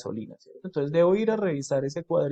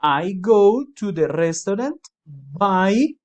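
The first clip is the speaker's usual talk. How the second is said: slow and louder, leaving the gap at the end; slow louder model; 3 semitones higher, 6 dB louder, much slower than usual. Shown as a demonstration. Teacher to students, modelling the blank slowly and louder. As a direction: read it slowly so they can fill the blank.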